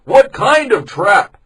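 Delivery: high, loud, slow voiced question; a villager questioning the plan; another villager. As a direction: ask it loudly and slowly. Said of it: "asked another man".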